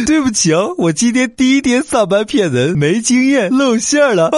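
high, giggly embarrassed caller; sheepish scammer voice. Acted. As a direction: play the rookie scammer laughing it off.

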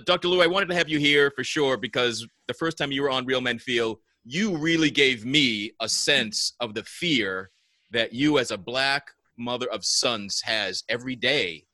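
normal talking voice

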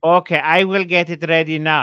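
imitating mom. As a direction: mimic mom agreeing on the phone. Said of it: "And she's like".

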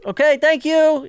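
high, slow, overly polite thanks; meek thanks. Retold or imitated. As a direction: sweetly placate the cop. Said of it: "and I just".

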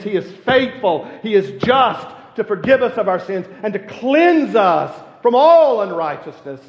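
loud, ringing pulpit preaching; loud, rising preacher's fervour; preaching at full force. Acted. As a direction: preach it out to the whole church.

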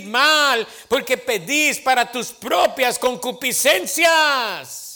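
loud fired-up preacher; loud sermon delivery; preaching. Acted. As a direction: proclaim it to the congregation. Pastor preaching at full force.

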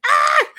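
high loud playful shriek; mock scream. Planning to startle the mom, high shriek.

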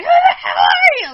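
high, loud shriek, acted out; freaking out. Retelling her reaction to the stranger, freaked-out shriek. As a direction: shriek it like she's panicking. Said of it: "Like, freaking out".

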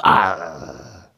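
low, loud growl; bear growl. Acted. Papa Bear sets off searching, low growl.

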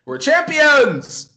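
loud high victory cry; triumphant shout. Pretend. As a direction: shout it like a winning boxer. Arms up like Rocky.

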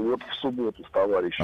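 hoarse and quick, hungover; rough voice after drinking. Real, not acted. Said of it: "А что с голосом".

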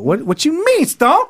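high indignant question; mock disbelief. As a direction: squeal it in disbelief. Mock outrage at friend.

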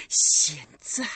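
a threat breathed out; menacing whisper. Acted. Recluse to the girl, whispered menace. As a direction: hiss it in a whisper.

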